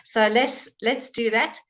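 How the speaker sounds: ordinary talk, nothing marked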